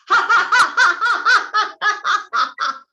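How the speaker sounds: high, loud cackling belly laugh. Shown as a demonstration. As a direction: throw your head back and laugh out loud. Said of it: "you throw your head back and you go".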